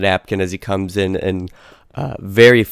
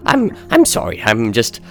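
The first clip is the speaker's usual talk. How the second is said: much higher, louder fussy announcer voice; gnome announcer; 4 semitones higher, 7 dB louder than usual. Acted. Sweaty gnome announcer introducing himself at the door, high and loud. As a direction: squeak it out like a flustered little gnome.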